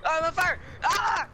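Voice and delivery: high, loud panic; panicked yell. Real, not acted. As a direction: scream it out in alarm. Character burning in game.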